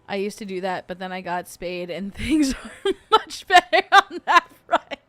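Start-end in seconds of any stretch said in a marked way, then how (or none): 2.1-5.0 higher, louder, breaking into laughter; laughing. Real, not acted. Joking about getting spayed, laughing. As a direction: crack up while finishing the joke. Cracking up at own spayed joke.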